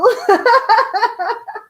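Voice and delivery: loud, high burst of laughter. Real, not acted. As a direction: laugh out at the joke. Laughing at own joke.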